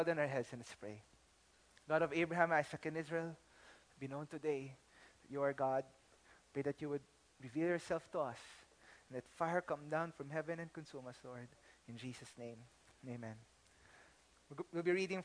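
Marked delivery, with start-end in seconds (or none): 1.9-13.3 soft, low reverent prayer; hushed opening prayer. Acted. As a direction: pray quietly for the congregation.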